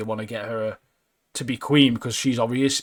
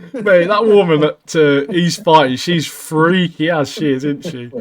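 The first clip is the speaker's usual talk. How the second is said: loud, slow, laughing through the words; can barely get it out for laughing; 5 semitones higher, 9 dB louder, slower than usual. Real, not acted. Laughing about the mud fight.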